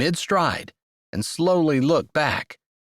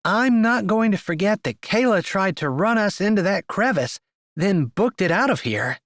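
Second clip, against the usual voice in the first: put-on higher, teasing young woman voice; sassy young woman; 8 semitones higher than usual. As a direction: voice her like a sharp-tongued friend. Harper's lines, narrator voices woman.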